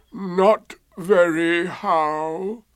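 drawn-out mournful complaint; Eeyore. A narrator's reading. Eeyore answering how he is, gloomy. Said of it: "in a gloomy manner to him".

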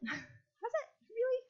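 high-pitched, soft sceptical questioning; mock doubt. Preacher teasingly doubts that Peter understood, high sceptical squeak.

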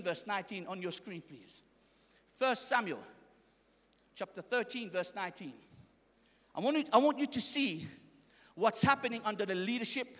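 ordinary delivery

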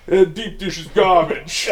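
slow, high mock New Yorker; a hammed-up New York voice; bad New York accent. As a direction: drag it out like a fake Brooklyn local. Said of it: "Mine was bad".

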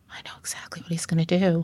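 hushed whisper to oneself; whispered thought. Retold or imitated. Woman recalling her silent thought in the car, whispered. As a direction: whisper it under the breath, almost voiceless.

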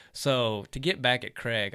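ordinary talk

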